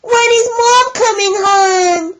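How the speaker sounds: high, loud piggy squeal. Acted. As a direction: squeal it like hungry piglets. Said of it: "the boys squealed".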